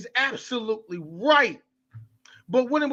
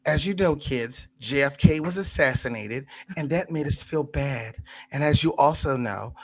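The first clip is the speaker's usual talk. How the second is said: put-on lower, talking down to kids; mock history teacher; 4 semitones lower than usual. Parodying the teacher who taught him history as a kid, lower and preachy. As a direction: parody a patient schoolteacher's tidy lesson. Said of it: "That's the way that I was taught history".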